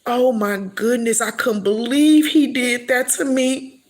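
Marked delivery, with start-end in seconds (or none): none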